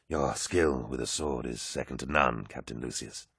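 low, soft compliment; soft, low, measured tribute; winded, gracious. A narrator's reading. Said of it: "breathing hard".

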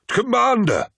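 high, loud alarmed shout. A narrator's reading. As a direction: call out to the fallen commander. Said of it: "shouted Torgaddon".

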